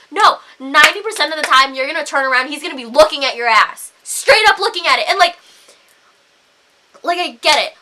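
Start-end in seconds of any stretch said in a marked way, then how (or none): none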